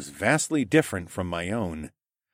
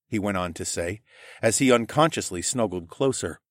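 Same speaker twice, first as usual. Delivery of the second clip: ordinary delivery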